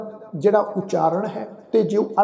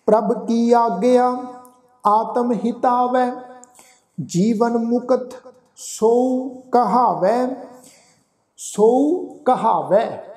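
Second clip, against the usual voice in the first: slow, measured sacred verse; scripture recitation; 2 semitones higher, much slower than usual. Shown as a demonstration. Teacher reciting Gurbani for the congregation, slow recitation. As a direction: recite slowly, drawing out the long vowels.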